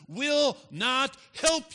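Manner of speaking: each word of "will not help" punched high and loud; emphatic preaching. Real, not acted. Preacher driving home a warning.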